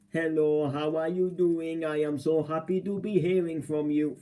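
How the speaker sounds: retold phone greeting in an Indian accent; imitated Indian accent. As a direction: put on an Indian call-centre accent. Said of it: "she was doing an Indian accent".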